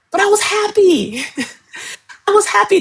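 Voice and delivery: giggling high through the words; laughing. Real, not acted. Coach recalling her happier heavier days, laughing high. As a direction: let the laugh carry it up high.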